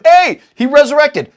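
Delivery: hammed-up eager women; mock excited announcement. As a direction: burst in with the news. Women telling disciples.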